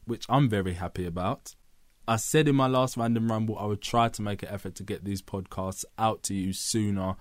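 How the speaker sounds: usual speaking voice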